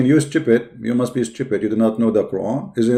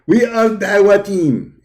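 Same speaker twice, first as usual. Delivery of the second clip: higher, slow, proud announcement; mock Dawah caller; 9 semitones higher, 3 dB louder, slower than usual. Streamer playing the Dawah team introducing itself, hammed-up higher voice. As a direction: puff up like a self-important team.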